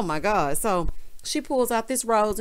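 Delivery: usual speaking voice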